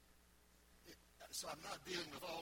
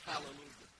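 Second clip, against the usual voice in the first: normal talking voice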